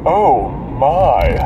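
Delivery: deep drawn-out "oh my"; lightly voiced George Takei.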